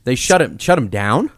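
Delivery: ordinary delivery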